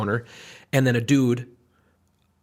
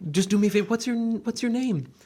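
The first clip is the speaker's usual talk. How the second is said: pleading mock voice; 9 semitones higher than usual. Customer begging a shop regular for his name, small high plea.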